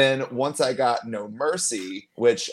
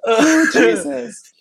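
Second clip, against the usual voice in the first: exasperated; 7 dB louder, much slower than usual. Real, not acted. Exasperated reaction to joke.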